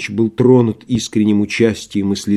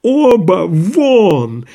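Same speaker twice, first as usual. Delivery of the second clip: high, drawn-out yell; furious shouting; 8 semitones higher, 4 dB louder, much slower than usual. A narrator's reading. Doctor ordering both visitors out, shouting. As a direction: keep yelling, stretching each word. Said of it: "продолжал кричать Андрей Ефимович".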